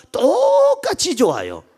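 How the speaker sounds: first syllable of 똑같이 stretched high; emphatic insistence. Retold or imitated. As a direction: hold the start of 똑같이 long and high, insisting. Grown child insisting both are equal.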